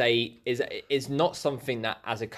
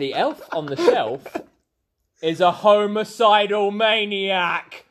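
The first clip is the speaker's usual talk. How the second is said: dramatic reveal; 7 semitones higher, 7 dB louder than usual. Acted. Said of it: "I've got my book".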